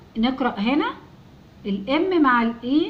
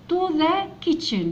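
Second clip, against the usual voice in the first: to the kitchen, slowly and higher; slow reading; 5 semitones higher, much slower than usual. Shown as a demonstration. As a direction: read slowly for learners. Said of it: "teacher reads slowly". (قراءة بطيئة)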